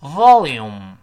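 "volume" slow and loud, closing lips on final m; slow teaching pronunciation. Shown as a demonstration. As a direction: model "volume" carefully, first syllable stressed. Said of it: "我们慢慢读一下". (慢慢示范“volume”，结尾闭唇)

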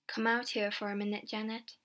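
ordinary delivery

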